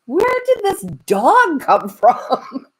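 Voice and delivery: high, slow, laughing disbelief; baffled surprise. Retold or imitated. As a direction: stretch it out, amazed at the dog. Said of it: "I'm like".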